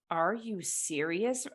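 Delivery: hushed mock disbelief; retold disbelief. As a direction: recount being stunned, drawing the words out. Retold amazed reaction to the remark.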